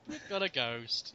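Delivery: low, slow, quiet and unimpressed; underplayed, dropped low and soft; deadpan understatement. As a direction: say it slowly as a weary matter of fact. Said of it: "It's like".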